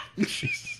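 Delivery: breathless squeal of laughter, very high. Real, not acted. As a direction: crack up in a high unvoiced squeal. Laughs after own impression.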